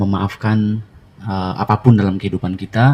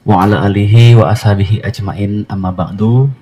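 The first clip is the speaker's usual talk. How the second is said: prayer recitation; 4 dB louder than usual. Real, not acted. Host prays before guiding the gathering, measured Arabic recitation. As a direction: recite it as an opening supplication.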